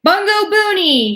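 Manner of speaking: very high, loud, slow scream. A narrator's reading. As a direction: scream it onto your toes. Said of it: "he screamed".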